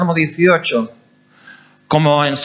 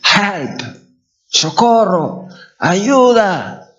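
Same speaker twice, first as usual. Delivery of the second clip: raised, restrained calls for help; crying out; 5 semitones higher, much slower than usual. Retold or imitated. Preacher recalling how he cried out, half-force calls. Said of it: "clamó".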